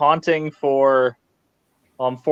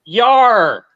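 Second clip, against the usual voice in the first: gruff pirate yar; 6 semitones higher, 4 dB louder than usual. Pretend. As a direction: growl it like a pirate. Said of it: "Talk like a pirate day".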